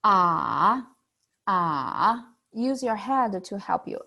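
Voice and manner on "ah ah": "Ah" dipping low, then rising; a long thinking "Ah" that sinks and climbs; third tone. Shown as a demonstration. Teacher demonstrates the hardest tone, dipping then rising. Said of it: "thinking sound".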